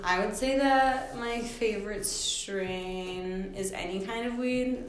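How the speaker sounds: stoned. Real, not acted. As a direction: drag through it, baked and foggy. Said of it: "Sorry, I sound so stuck".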